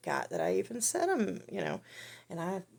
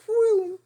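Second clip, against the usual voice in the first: loud, high whoop of someone falling; sudden high "whoom"; imitated tumble noise; 13 dB louder than usual. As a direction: mimic a man toppling off, high and loud. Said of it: "He goes".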